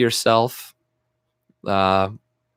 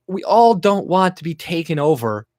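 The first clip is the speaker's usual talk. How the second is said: ordinary talk, nothing marked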